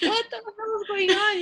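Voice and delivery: mock confusion. Client's confused reaction voiced.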